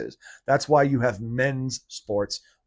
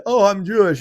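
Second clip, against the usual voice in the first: high, hammed-up Yiddish accent; 7 semitones higher, 5 dB louder than usual. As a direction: put on a jokey Yiddish New York accent. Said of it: "spoke with a Yiddish accent".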